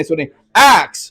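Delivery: एक्स said slowly, the ks drawn out; slow एक्स, lingering on the ks; listen to X. Shown as a demonstration. Teacher asks the class to hear एक्स, slow. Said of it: "ये sound check करें".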